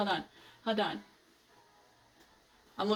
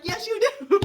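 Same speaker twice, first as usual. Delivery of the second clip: excited cheer; 10 semitones higher, 5 dB louder than usual. Retold or imitated. Cheering on a book heroine.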